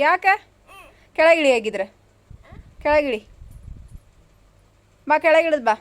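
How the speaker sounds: loud, high parental command; loud ordering. Real, not acted. Parent ordering child to climb down.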